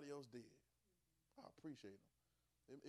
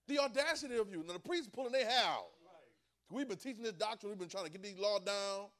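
outraged priests; 7 semitones higher, 11 dB louder than usual. Acted.

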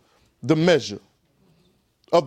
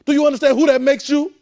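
higher, louder, rising challenge; fervent preaching shout; 9 semitones higher, 6 dB louder than usual. Real, not acted. Preacher challenging the congregation about their identity, shouting. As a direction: drive the question home at full force. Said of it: "Cal-, calm down".

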